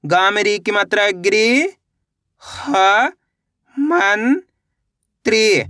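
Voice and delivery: very slow, each syllable drawn apart. Shown as a demonstration. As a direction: break गृह-मं-त्री into slow pieces. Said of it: "पहले गृह पढ़ो, इसके बाद मंत्री पढ़ो". (टुकड़ों में धीरे-धीरे)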